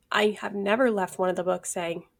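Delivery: ordinary talk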